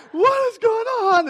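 loud, high, drawn-out mock wail; toddler losing it. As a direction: imitate a hysterical small child, stretched out. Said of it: "now he's just lost it".

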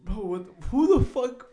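high, loud incredulous scolding; mock exasperation. Friend asking who cooks pizza on the bare rack, mock exasperated. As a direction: imitate a friend who can't believe the stupidity. Acted quarrel over pizza.

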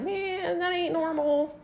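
high, softened aside; high-voiced mock worry; retold disbelief. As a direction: pitch it up and keep it small. Roommates react to weird neighbor.

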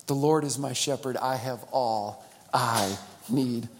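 slow, soft and low, savouring each word; tender scripture. Acted. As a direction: let the verse settle gently. Slow intimate reading of scripture.

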